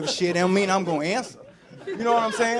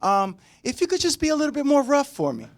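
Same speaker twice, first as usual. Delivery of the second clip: a breathy-sounding partner asking, higher pitched; imitated request; 5 semitones higher than usual. Comedian quoting a partner asking for rougher play, mock coaxing.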